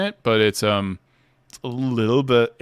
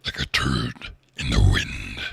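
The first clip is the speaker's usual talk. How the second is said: low, slow gravelly Venom line; a touch of the Venom growl, slowed; Tom Hardy as Venom; 13 semitones lower, slower than usual. Retold or imitated. Streamer trying his Venom voice for chat, low and slow. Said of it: "practice Tom Hardy voices".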